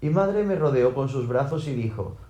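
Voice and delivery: ordinary delivery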